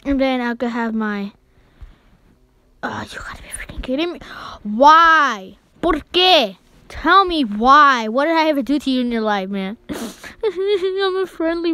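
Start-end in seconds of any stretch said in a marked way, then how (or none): 2.8-4.2 fast, breathy whisper; exasperated whisper; whispered frustration. Real, not acted. 4.6-8.1 loud, drawn-out why; louder mock-tragic plea; dramatic lament. Real, not acted. Dramatic complaint at opponent.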